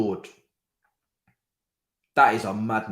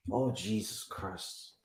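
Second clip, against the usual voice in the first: a near-breathed slow groan, much softer; softer breathy despair; 4 semitones lower, 12 dB quieter, much slower than usual. Real, not acted. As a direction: fade it out under the breath. Despairing at match events.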